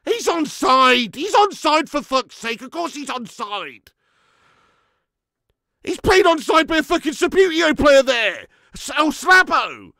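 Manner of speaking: high, loud cries of despair; exasperated shouting. Real, not acted. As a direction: yell it out in angry protest. Protests onside goal against team.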